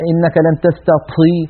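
"إنك لن تستطيع" with a gush of air on the final ط syllable; aspirated ط, a mistake. Shown as a demonstration. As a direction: mimic the error, breath escaping at "طيع" in "تستطيع". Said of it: "مع هذا الهواء المتدفق". (تلاوة "إنك لن تستطيع" بطاء مهموسة)

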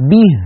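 به said "bih" with a pure "i"; short, clear close vowel in به; به with "i", never "e"; correct kasra. Shown as a demonstration. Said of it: "هذه الكسرة الثانية صحيحة".

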